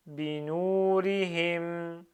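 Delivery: "Binurihim" very slow, with a long u; emphasised prolongations. Shown as a demonstration. Teacher reads in one go, "Binurihim" with prolongation stressed. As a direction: hold the u of "Binurihim". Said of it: "J'essaie de souligner les prolongements".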